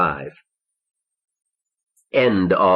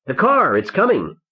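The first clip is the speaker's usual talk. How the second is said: high-pitched call of alarm; narrator's restrained shout, pitched way up; excited shout; 10 semitones higher than usual. A narrator's reading. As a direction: call it out high, announcing an arrival. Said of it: "Paul shouted".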